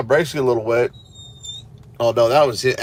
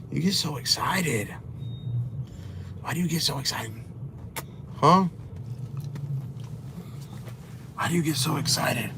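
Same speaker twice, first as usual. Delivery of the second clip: softer, cooing voice for the dog; 10 dB quieter than usual. Real, not acted. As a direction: coo gently to calm the dog. Baby talk to excited dog.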